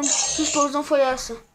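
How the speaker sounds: loud alarmed question; startled shout. Real, not acted. Repeats after Como.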